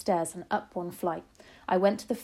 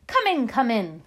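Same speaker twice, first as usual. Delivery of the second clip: loud, higher impatient snap; witch snapping; 4 semitones higher, 7 dB louder than usual. A narrator's reading. Grand High Witch summoning ancient witches, snapping. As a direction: snap the order out loudly. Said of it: "snapped the Grand High Witch".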